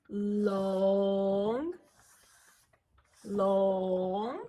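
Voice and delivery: each long sustained and held; held long notes. Shown as a demonstration. Teacher showing long beats, sustained. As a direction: hold it like a long beat. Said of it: "And now this is".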